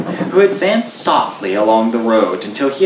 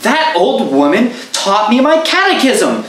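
high, loud horrified outburst; stunned horror; 11 semitones higher, 4 dB louder than usual. Acted. Goodman Brown to the traveler, stunned and horrified, high. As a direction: blurt it out aghast. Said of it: "in stunned horror".

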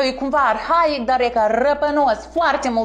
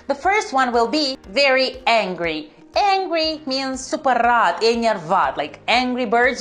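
usual speaking voice

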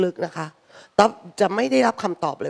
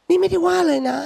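higher, louder "นี่ไม่ได้ว่าเลยนะ"; escalating protest; 12 semitones higher, 4 dB louder than usual. Retold or imitated. Quarrelling couple, denial escalates higher and louder. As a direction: push the denial harder. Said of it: "ทะเลาะด้วยประโยคที่ว่า".

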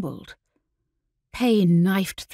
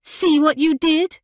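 high, accusing outburst; sharp, high-pitched scolding; berating; 7 semitones higher, 4 dB louder than usual. Acted. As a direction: berate your twin for the mishap. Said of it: "Emily berated her twin".

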